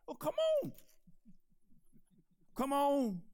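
high, inviting call; eager calling. Retold or imitated. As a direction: urge him out onto the water. Jesus calling Peter to come.

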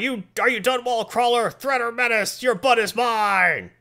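high, loud mock yelling, slowing at the end; yelling J. Jonah Jameson. Cyborg Jameson yelling at Spider-Man, hammed-up high yell. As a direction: bellow it like a ranting newspaper boss. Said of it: "Who starts yelling".

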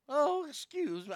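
put-on quick high old lady squeak; a hint of a frail old woman. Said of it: "where I did the old lady voice".

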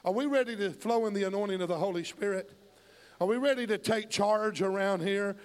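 driving, rhythmic pulpit cadence, building in force; fervent preaching. Real, not acted. Preacher rallying the congregation to act like Peter, fervent.

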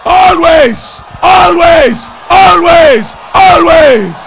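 high, very loud repeated shouts; triumphant shouting. Real, not acted. Preacher telling congregation God always causes triumph, triumphant shouting. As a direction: hammer each word like a fired-up preacher.

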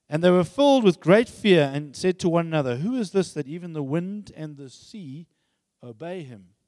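loud, measured Bible reading; reading Mark aloud from the pulpit, strong and steady; scripture reading. Acted. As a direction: proclaim the passage to the congregation. Let me read that.

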